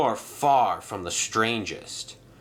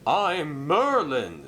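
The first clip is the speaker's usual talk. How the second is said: slow, higher proud self-introduction; 6 semitones higher, slower than usual. Acted. Merlin introducing himself to Arthur, slow and proud. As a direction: announce your name with pride. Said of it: "said the engine proudly".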